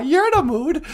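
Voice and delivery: high voice through laughter; laughing puppet. Pretend. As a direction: laugh while teasing.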